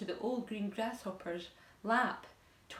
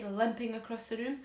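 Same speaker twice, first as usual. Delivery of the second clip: usual speaking voice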